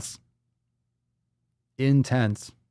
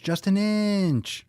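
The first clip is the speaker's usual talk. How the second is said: drawn-out plea; 9 semitones higher, much slower than usual. Pretend. Repeats the line, drawn out.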